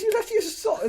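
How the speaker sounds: very high, disbelieving squeak; retelling his own disbelief at the wife; incredulous. Retold or imitated. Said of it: "I says".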